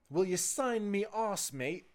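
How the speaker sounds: a terrible British accent, mock bloke; bad British accent. A fan asking for his butt signed, in a bad British accent. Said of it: "that's my British accent".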